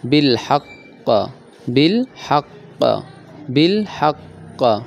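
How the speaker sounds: slow বিল হাক্ক with a hard doubled ক; strong qalqala. Shown as a demonstration. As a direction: drag বিল হাক্ক, stress the ক. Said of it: "strong qalqala demo".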